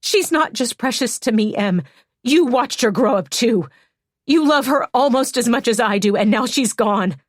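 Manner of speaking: loud furious shout. Acted. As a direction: let the grief turn to a loud shout. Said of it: "Hannah's voice was so loud".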